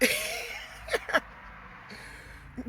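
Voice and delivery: airy giggle, almost crying; breathy laugh. Real, not acted. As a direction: laugh helplessly at the memory. Laughs at the memory.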